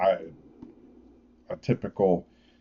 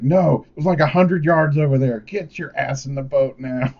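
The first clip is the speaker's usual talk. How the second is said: loud, high, insistent retort; urgent yell ordering someone to safety; freaking out; 7 semitones higher, 6 dB louder than usual. Retold or imitated. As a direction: imitate a frantic friend shouting a warning. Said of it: "he's freaking out".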